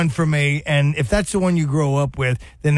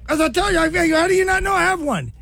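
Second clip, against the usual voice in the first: high, strained mock Connery voice; 13 semitones higher, faster than usual. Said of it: "He goes".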